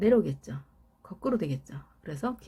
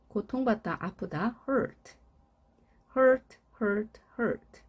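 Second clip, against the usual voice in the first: ordinary delivery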